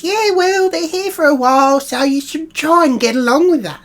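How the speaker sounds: high, loud nagging husband; imitating husband. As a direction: mimic hubby telling her off. Husband Terry's voice retold.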